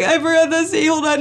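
high, loud wailed admission; mock-tearful panic. Wife confessing the missing letter, tearful panic. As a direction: wail it out over a blunder. Tearfully realises her mistake.

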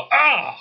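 short high-pitched "aah"; cartoonish cry of riders about to crash; mock scream. Game master voices scooter drivers colliding, mock scream.

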